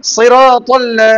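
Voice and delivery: সিরাতাল্লা drawn out slowly, loud and clear; slow model recitation. Shown as a demonstration. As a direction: spell out সিরাতাল্লা slowly for a child to copy. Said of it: "teacher models for student". (ধীরে ধীরে টেনে শেখানো)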